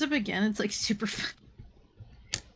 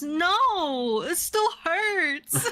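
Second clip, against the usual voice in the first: high, slow drawn-out whine; whiny villain; 8 semitones higher, much slower than usual. Retold or imitated. Villain refuses to keep fighting the hero, slow high whine. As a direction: stretch it out like a sulking child. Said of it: "And he goes".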